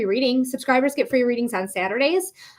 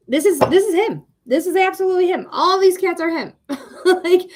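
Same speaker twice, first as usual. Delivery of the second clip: excited; 7 semitones higher, 4 dB louder than usual. Real, not acted. Excited over cat photos.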